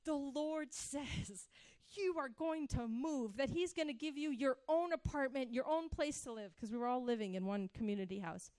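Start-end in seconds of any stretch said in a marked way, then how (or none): 0.0-6.5 a mild hint of a young prophet's solemn tone; prophetic word. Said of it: "and I say".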